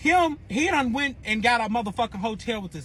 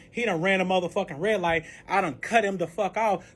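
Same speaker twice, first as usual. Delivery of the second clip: ordinary talk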